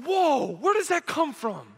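high, drawn-out mock astonishment; acted slow "whoa" of surprise; startled reaction. Acted reaction to a child cussing.

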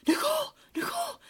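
high panicked calling of a name; frantic calling. Retold or imitated. Imitating roommate waking her, high frantic calls. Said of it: "She's like".

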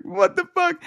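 high, strained mock scream at normal volume; imitated scream. Homeowner reacts to the worker, imitated high scream. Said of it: "So he's screaming".

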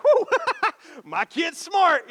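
put-on smug parent laughing at a kid hitting a wall; mock proud parent. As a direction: cheer your kid with a laugh. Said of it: "you're like".

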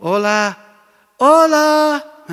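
loud, high, lazy mock hello; mocking teen greeting. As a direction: imitate a bored teen's hello. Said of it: "Los miras y te miran". (saludo burlón de adolescente, agudo y fuerte)

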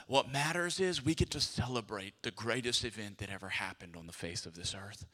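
normal talking voice

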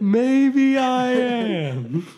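slow, drawn-out and rising; dramatic. Acted. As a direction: ham up the mystery. Dramatic catchphrase again.

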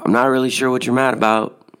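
mild call out a car window; retold yell. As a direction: reenact hollering over to the next car. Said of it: "I just yell out my window".

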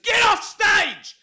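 loud, slow, high bellow; mock furious DJ yell; shouted command. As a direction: roar it at the stage invader. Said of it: "I said".

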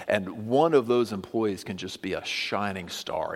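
usual speaking voice

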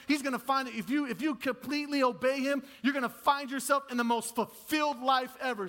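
loud, high preaching build; full-force preaching. Acted. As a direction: lift the room with conviction.

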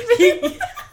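high squealing burst of laughter; shrieking laugh. Real, not acted. Cracking up at the lid joke, a high shriek of laughter.

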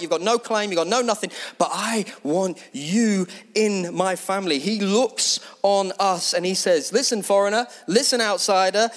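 rising, insistent preacher's call; preaching at full force. Acted. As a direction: preach it hard to the congregation. Passionate preaching climax.

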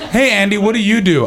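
high, keen party small talk; mock eager question. Partygoer making small talk with a tennis star, high and eager. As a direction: pitch it up, playfully eager. Partygoer chats up Andy Murray.

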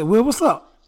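exaggerated New Orleans greeting, high; New Orleans accent. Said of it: "don't start this fake New Orleans accent you be doing".

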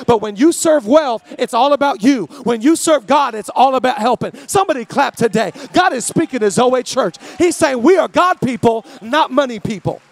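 loud preacher's punch on each phrase; preaching at full force. Acted. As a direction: drive every line home to the church. Call and response with congregation.

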